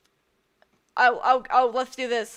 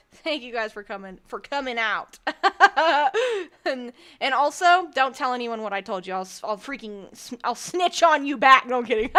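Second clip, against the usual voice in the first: ordinary delivery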